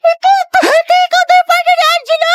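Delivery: high squealing announcement; best friend squealing the crush news; excited call out. Acted. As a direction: call her name in delight.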